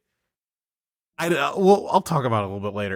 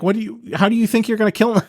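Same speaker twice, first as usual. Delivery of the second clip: higher, fast, incredulous; incredulous laughing; 9 semitones higher, much faster than usual. Real, not acted. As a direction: rattle it off in disbelief, then laugh. Mocking the character, laughing.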